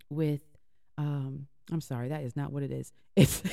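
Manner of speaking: ordinary delivery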